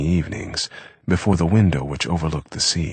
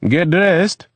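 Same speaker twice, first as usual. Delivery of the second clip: called from the kitchen, higher and louder; 7 dB louder than usual. A narrator's reading. Eve calling to Eric from the kitchen, loud call. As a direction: call it out to the next room. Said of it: "he called".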